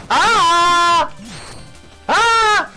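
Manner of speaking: long high shriek; high villain shriek. Acted. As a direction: shriek like a defeated boss. High boss cry.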